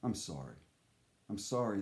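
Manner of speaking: ordinary delivery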